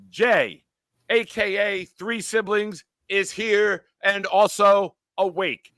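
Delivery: high, slow mock-grand announcing; mock announcer. Host heralds a sleepy viewer, slow and grand. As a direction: stretch out the name like a herald.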